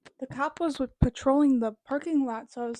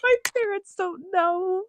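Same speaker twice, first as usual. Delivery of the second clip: higher, louder, slow laughing plea; 7 semitones higher, 7 dB louder than usual. Real, not acted. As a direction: plead through embarrassed laughter.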